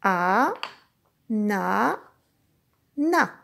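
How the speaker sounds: "A, na, na" slowly, a long pause after each syllable. Shown as a demonstration. Teacher models "A, na, na" for a child, syllable by syllable. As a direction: stretch "A, na, na", rising first, falling at the end. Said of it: "tu vas refaire comme moi".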